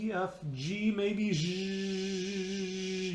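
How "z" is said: long voiced "zhhh" on a pitch; sustained zh hum. Shown as a demonstration. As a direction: hold the buzzing G consonant on one note. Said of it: "You could give that a pitch".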